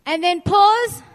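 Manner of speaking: big rising teacher cue; loud cue. Real, not acted. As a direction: call out pause loud and high. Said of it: "class recites steps".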